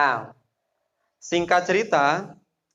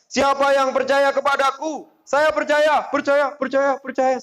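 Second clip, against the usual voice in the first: higher, eager call to the crowd, repeating percaya; rally them to say they believe; eager asking; 9 semitones higher than usual. Retold or imitated. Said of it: "Rasulullah bilang". (ajakan bersemangat)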